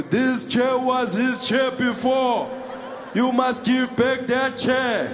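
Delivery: orate like a political firebrand; slow booming political speech about the chair; Julius Malema. Retold or imitated. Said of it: "if only that Australian guy knew Julius".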